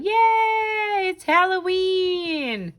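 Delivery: high, slowed squeal of delight; happy skeleton cheer. Pretend. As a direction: give the paper skeleton a thrilled high cheer. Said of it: "Looks like he's going".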